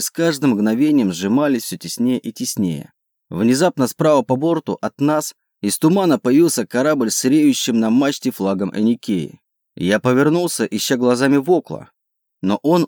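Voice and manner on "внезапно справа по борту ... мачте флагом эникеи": ordinary delivery, nothing marked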